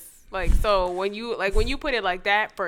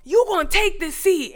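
high, loud mock command; single someone out with a raised, high order; imitating loud man; 10 semitones higher, 8 dB louder than usual. Man ordering a newly boarded woman to sit, loud mock command. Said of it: "there was this loud-ass man".

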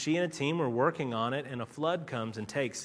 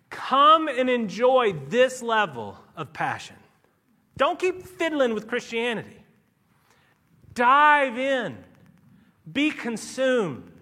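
loud, slow, soaring call to dive in; full-force altar call; passionate preaching; 7 semitones higher, 9 dB louder, slower than usual. Acted. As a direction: preach it to the rafters. Climax of sermon appeal.

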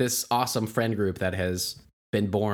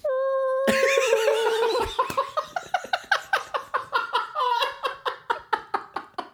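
breathless helpless laughter, high up; long wheezing fit of laughter; long high hearty laugh. Real, not acted. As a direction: lose it completely laughing. Cracking up at the joke.

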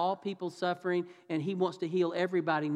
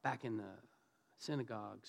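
low, soft, halting awkward excuse; sheepish, hesitant mumbled excuse; awkward brush-off; 5 semitones lower, 9 dB quieter than usual. Acted. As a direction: fumble an uncomfortable brush-off to an old friend. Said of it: "can you imagine how awkward that was?".

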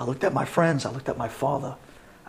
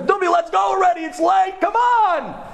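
high, loud impatient yelling; teen friends shouting for him to hurry up; friends calling out; 14 semitones higher, 3 dB louder than usual. Retold or imitated. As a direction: call him over from across the street. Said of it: "I hear my friends".

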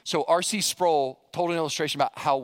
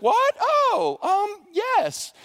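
flustered surprised Mary; 4 dB louder, much slower than usual. Retold or imitated. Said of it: "She's like".

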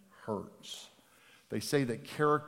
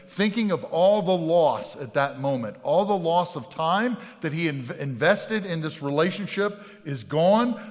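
louder, higher impassioned preaching; preaching at full force; 4 semitones higher, 5 dB louder than usual. Acted. Pastor preaching passionately to his congregation. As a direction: pour it out from the pulpit.

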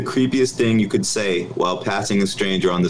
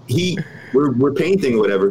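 usual speaking voice

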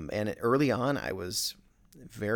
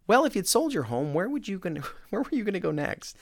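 pushy salesman; 10 semitones higher, 4 dB louder, faster than usual. Retold or imitated. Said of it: "retold cold call script".

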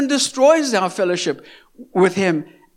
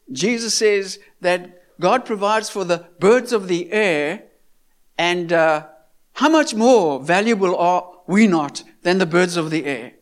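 ordinary talk, nothing marked

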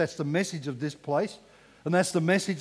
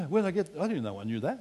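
ordinary talk, nothing marked

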